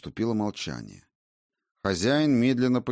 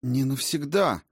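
higher, indignant objection; indignant protest; 6 semitones higher than usual. A narrator's reading. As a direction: bristle with righteous anger. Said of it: "возмутилась Валькирия".

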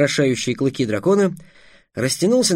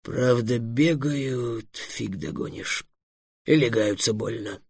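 low, unhurried dragon voice; honest dragon; 3 semitones lower, 7 dB quieter, slower than usual. A narrator's reading. Dragon admitting the catch to his companion, candidly. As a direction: softly admit it, pitch sinking. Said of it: "честно добавил".

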